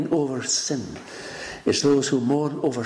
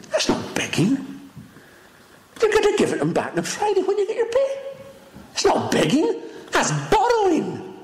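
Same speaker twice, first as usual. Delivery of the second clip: rising preaching. Acted. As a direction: drive the point home, climbing higher.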